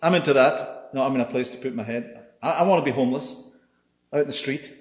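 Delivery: mock a keen recruit signing up; mock eager volunteer. Mocking eager would-be follower.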